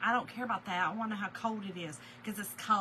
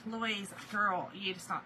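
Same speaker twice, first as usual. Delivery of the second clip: normal talking voice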